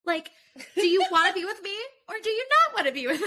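mock teacher questioning her fiancé. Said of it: "I feel like a teacher".

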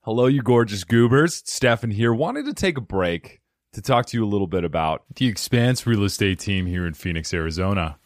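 loud, punchy sponsor read. Acted. Podcast host reading a real estate sponsor ad, punchy ad read. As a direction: sell it like a host ad spot.

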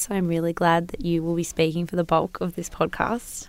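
usual speaking voice

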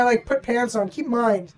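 ordinary delivery

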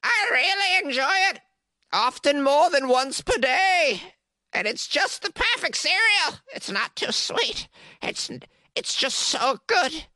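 put-on high, slow old lady testimonial; Carrie, ancient woman. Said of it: "That's how she talks".

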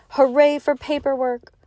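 high, rousing cheer; crowd cheer. A narrator's reading. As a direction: cheer it along with the audience. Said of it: "as the cheering continued".

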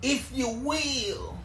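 high, slow, drawn-out impression of a wrestling legend; Dusty Rhodes voice. Host slips into a Dusty Rhodes impression on his show, high and slow. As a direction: stretch it out like Dusty doing a promo. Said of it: "I'm trying to do my Dusty voice".

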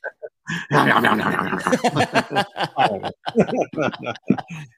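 growly "om nom nom" gobbling noise; greedy monster chomping, trailing into "mmm"; Cookie Monster munching. Shown as a demonstration. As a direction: devour cookies noisily in a monster voice. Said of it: "[name] does a great Cookie Monster".

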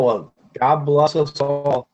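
normal talking voice

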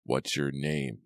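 plain reading voice

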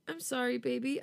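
high, tearful apology; tearful sorry to her husband; sobbing. A narrator's reading. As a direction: sob the apology out. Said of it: "I sob".

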